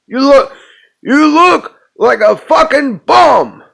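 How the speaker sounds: high, loud hammed-up insult; yelled movie quote. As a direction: shout it like an irate film character. Quoting a film, yelling.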